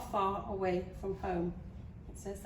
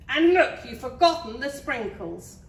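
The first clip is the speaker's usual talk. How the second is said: loud, high princess outburst; exclaimed complaint; 5 semitones higher, 11 dB louder than usual. A narrator's reading. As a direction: exclaim it as an indignant accusation. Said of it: "she exclaimed".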